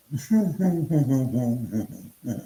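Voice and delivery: drawn-out run of chuckles. Real, not acted. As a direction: chuckle in a string of short pulses. Speaker's own laugh after reply.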